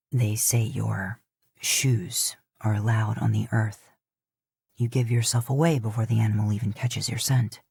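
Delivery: calm warrior explaining, a touch amused; lightly voiced Shota. A narrator's reading. Shota explains to Dean why he fails, low and dry.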